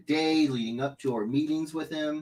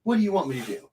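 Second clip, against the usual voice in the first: normal talking voice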